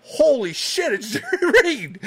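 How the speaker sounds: high, loud astonished gasp of words; dad caught completely off guard, laughing; stunned disbelief. Retold or imitated. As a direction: blurt it out, starstruck. Dad sees a famous singer.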